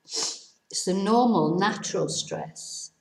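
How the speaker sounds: ordinary delivery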